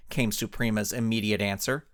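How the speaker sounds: ordinary delivery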